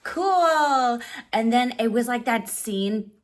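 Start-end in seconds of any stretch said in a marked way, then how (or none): 0.0-1.0 high, loud "cool"; thrilled little cheer; excited. Retold or imitated. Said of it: "and I'm like".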